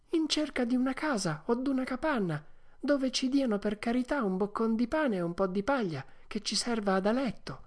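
high puppet son's voice; Pinocchio. Acted. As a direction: answer a tired father hopefully. (voce acuta di burattino, premurosa)

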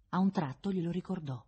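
usual speaking voice

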